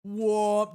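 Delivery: loud imitated boom filling the house; booming echo noise. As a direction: blast out a big "whoa" of sound. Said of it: "just everywhere".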